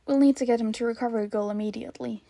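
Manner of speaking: usual speaking voice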